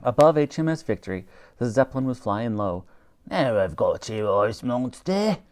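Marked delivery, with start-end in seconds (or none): 3.3-5.4 villain's cry. A narrator's reading. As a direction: gloat over the prey. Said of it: "came a cry from the gondola".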